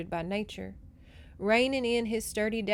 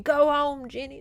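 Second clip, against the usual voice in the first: mild mock shout, raised and quickly dropping; retold shout; 5 semitones higher, 5 dB louder than usual. Reader re-enacts the rider's order, lightly shouted. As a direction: recap his command with a raised voice. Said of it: "and he's saying".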